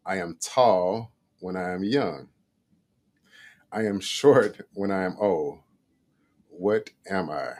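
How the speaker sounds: slow, deliberate riddle reading with long pauses. Shown as a demonstration. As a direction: read each phrase slowly so it lands. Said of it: "I'm gonna read one more".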